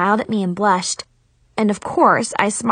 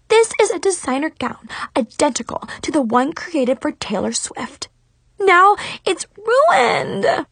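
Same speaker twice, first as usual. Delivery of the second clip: high outraged whine building to a wail; Mackenzie; 7 semitones higher than usual. A narrator's reading. Rival over her ruined designer gown, outraged wail. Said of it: "she screamed".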